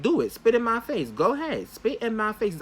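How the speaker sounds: loud, high taunting challenge; angry dare. Retold or imitated. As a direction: dare her to do it, loudly. Daring her during the fight.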